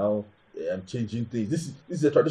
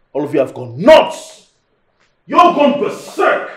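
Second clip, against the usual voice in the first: slow, loud, furious shout; explode, climbing high at the end; angry outburst; 4 semitones higher, 6 dB louder, slower than usual. Acted. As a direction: stretch the words out as you yell. Husband explodes at his wife.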